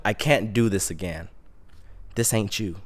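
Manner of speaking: low, quiet self-talk; hushed private vow; sober resolve. Retold or imitated. Acts out sober talk to himself.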